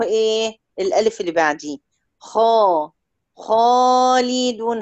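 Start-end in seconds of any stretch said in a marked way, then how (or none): 3.4-4.8 خالدون slowly, with a full heavy alif after the kha. Shown as a demonstration. Teacher shows a heavy alif to her student. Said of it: "هتلاقي الألف هنا مفخمة".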